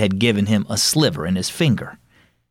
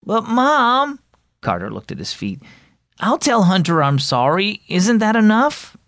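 put-on higher, whiny kid's plea; pleading boy; 10 semitones higher than usual. Boy pleading with his mom to skip punishment, higher and whiny. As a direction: beg to get off lightly.

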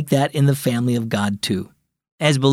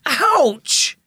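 sharp high yelp of pain, higher pitch; cry of pain; 12 semitones higher than usual. Acted. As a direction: yelp like you just hit your toe. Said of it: "she cried".

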